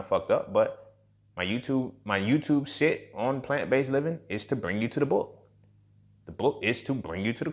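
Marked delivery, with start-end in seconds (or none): none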